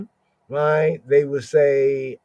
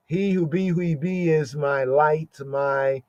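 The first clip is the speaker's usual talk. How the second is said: usual speaking voice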